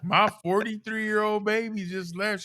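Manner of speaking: a mother's choked, high plea; mom's voice. Retold or imitated. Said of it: "She was like".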